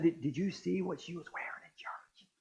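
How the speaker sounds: hushed gossip whisper; gossipy whisper. Retold or imitated. Pastor mimicking a churchgoer gossiping about clothes, whispering.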